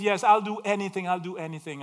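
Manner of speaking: desperate pleading. Acted. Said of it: "He says".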